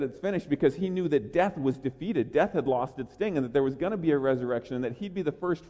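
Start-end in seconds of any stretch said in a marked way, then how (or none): none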